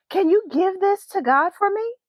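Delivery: put-on high, eager child; girl hands over her note, high and hopeful; little girl voice. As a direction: plead sweetly like a small girl. Talia hands Grandma her note.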